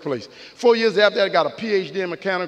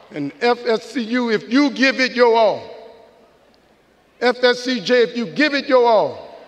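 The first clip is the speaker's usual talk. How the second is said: raised, higher-pitched rallying call; rousing rally cry; 4 semitones higher than usual. Real, not acted. Speaker urging graduates to give their all, rousing rally cry. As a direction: call out to the crowd, driving the last word home. Rallying both campuses at speech's climax.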